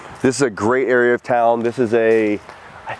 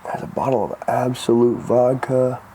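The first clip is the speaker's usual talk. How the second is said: ordinary talk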